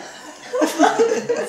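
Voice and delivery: high bursting laugh; delighted laugh. Real, not acted. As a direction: laugh out in relief. Baby swallows, mother laughs.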